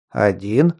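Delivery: low, puzzled questioning rise; surprised. A narrator's reading. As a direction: ask it in surprise. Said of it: "удивился бородач и хмыкнул".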